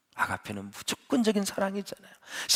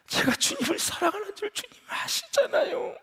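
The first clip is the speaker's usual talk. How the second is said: tearful plea; 14 semitones higher than usual. Retold or imitated. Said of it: "그때 울잖아요".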